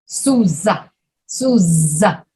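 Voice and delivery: Souza with a long buzzing Z; voiced Z. Shown as a demonstration. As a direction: stretch the Z in Souza. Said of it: "these words that have the Z sound in".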